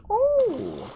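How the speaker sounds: very high, slow sing-song; delighted squeaky coo. Pretend. Player showing off a shiny find, high playful coo. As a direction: draw it out in a tiny high voice.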